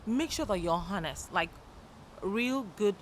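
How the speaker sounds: ordinary delivery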